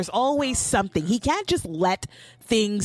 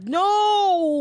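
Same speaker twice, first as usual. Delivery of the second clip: mock refusal; 10 semitones higher, 4 dB louder than usual. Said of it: "it's like".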